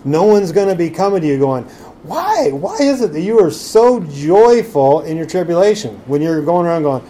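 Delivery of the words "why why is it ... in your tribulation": mock astonishment, opening very high; imitating amazed onlooker. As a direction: voice an onlooker baffled by someone's joy. Voicing a puzzled onlooker.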